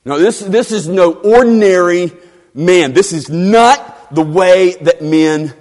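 NO ORDINARY MAN and NOT driven out loud and high; forceful emphasis. Shown as a demonstration. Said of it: "is to emphasize men don't speak like this".

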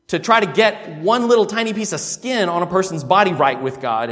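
ordinary talk

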